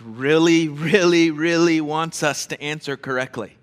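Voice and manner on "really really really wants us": each really punched louder; emphasis. Shown as a demonstration. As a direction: hammer the repeated really. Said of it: "This is really important".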